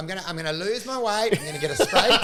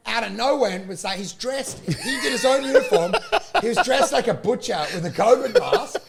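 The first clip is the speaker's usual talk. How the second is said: ordinary talk, nothing marked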